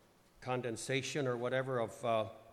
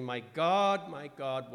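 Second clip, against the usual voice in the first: loud cry; 2 semitones higher, 9 dB louder, much slower than usual. Acted. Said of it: "Jesus cried in a loud voice".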